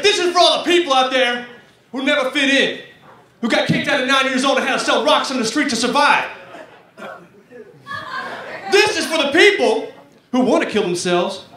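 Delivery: rally the crowd like a rock frontman; lead singer. Acted. Lead singer addressing the concert crowd, hammed-up dedication. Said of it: "a lead singer".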